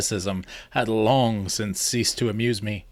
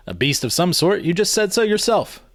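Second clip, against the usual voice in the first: protesting; 7 semitones higher than usual. A narrator's reading. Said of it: "I protested".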